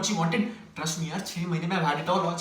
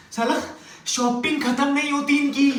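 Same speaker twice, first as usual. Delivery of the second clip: high-pitched exasperated outburst; exasperated rant; 7 semitones higher than usual. Acted. Man fed up with endless shopping, exasperated. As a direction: complain at the end of your tether.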